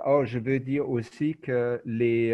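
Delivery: ordinary talk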